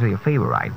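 "favorite" ending in -ite, like right; mispronounced favorite. Shown as a demonstration. Coach showing a word we don't pronounce right, mispronunciation. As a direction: say "favorite" as fave-uh-rite. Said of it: "we don't pronounce right".

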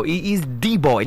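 "the" said as dee, with a hard d; wrong pronunciation. Shown as a demonstration. Teacher showing another wrong article, mispronounced. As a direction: turn "the boy" into dee boy. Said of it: "that is wrong, extremely wrong".

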